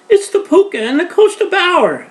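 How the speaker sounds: very high, loud panicked scream; frightened girl shrieking at the fire wagon; terrified shriek. Acted. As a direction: shriek at the galloping horses. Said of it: "Alice shrieked".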